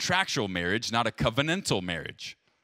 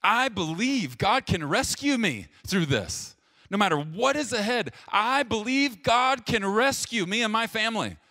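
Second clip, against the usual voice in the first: easy confident faith; 8 semitones higher than usual. Retold or imitated. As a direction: say it as an easy believer, a little higher. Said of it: "It's easy to say".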